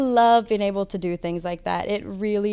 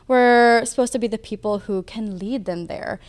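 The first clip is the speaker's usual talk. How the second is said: ordinary talk, nothing marked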